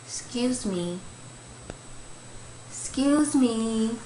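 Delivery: put-on slow, politely pleading child's voice; a grandson asking nicely again and again; mock-polite little kid's excuse me; polite little boy. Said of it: "using his polite voice".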